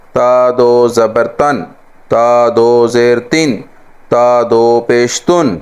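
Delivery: slow spelled-out tanween; traditional spelling drill. Shown as a demonstration. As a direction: spell each one slowly the old way. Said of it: "جو رسماً طریقہ". (آہستہ ہجے)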